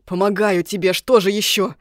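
out of breath, loud with effort; puffing. Acted. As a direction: pant it out while pushing. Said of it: "пыхтя отозвался эльф".

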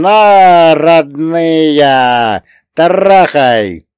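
high, loud daring yell; dashing shout. A narrator's reading. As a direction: urge the horses on with a whoop. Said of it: "закричал молодецким покриком".

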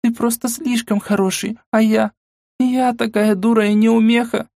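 сквозь слёзы, с надрывом; плачущая девушка корит себя; рыдая. Acted. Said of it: "рыдая всё сильнее". (рыдающий голос)

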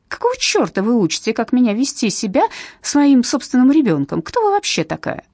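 loud, furious, rising to a high shriek; furious mother. Acted. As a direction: lash out at the stranger. Mother lashing out at social worker.

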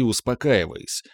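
ordinary delivery

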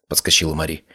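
ordinary delivery, nothing marked

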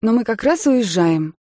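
loud, firm and high. A narrator's reading. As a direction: cut them off firmly. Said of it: "твёрдым голосом произнесла я".

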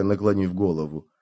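usual speaking voice

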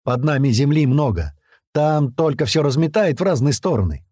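ordinary delivery

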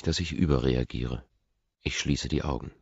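ordinary delivery, nothing marked

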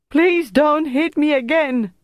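high-pitched desperate begging; crying out. A narrator's reading. Tortured prisoner begs not to be hit, crying out. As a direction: cry out as if dreading the next blow. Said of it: "Bertrand cried".

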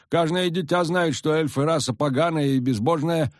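high, folksy village peasant; rustic old villager, eager. A narrator's reading. Peasant priest voice held.

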